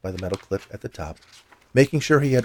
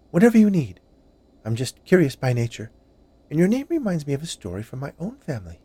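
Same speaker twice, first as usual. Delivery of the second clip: high-pitched, obliging woman; chatty confectioner; 7 semitones higher than usual. Acted. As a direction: chat on in a high, lilting voice.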